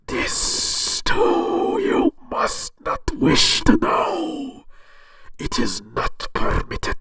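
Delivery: very high, slow, whispered voice through a cardboard tube; muffled tube god voice; through a toilet paper tube. Acted. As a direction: speak into a paper roll like a hollow oracle. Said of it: "speaking from the inside of a toilet paper roll".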